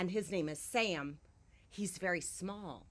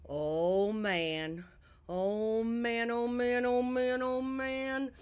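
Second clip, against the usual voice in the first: building higher and louder each "oh, man"; rising panic; 6 semitones higher, 7 dB louder, much slower than usual. Acted. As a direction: work yourself up in a panic. Panicking before her outburst.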